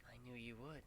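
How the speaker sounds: soft, warm and fond; warm smile. A narrator's reading. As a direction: smile through it. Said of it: "with a warm smile".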